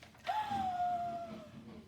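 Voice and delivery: sharp high gasp of surprise; big surprised gasp. Acted. Reading to kids, she gasps at the monkeys. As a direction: gasp as if spotting something amazing.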